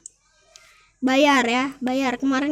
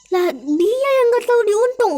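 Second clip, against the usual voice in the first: indignant retort; 7 semitones higher, 3 dB louder than usual. Acted. Istri membalas suami, bertengkar.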